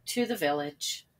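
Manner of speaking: ordinary delivery, nothing marked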